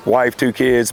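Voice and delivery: usual speaking voice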